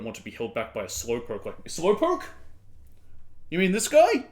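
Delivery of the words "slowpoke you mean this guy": high, loud playful exclamation; excited joke. Real, not acted. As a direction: pop up excitedly at the word. Reacts to the word slowpoke.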